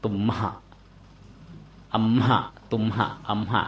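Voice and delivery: ตุมหะ อัมหะ with a held hum on ม released into a chesty หะ; chest-resonant h. Shown as a demonstration. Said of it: "หะออกที่อุรฐาน คือให้ก้องในอก".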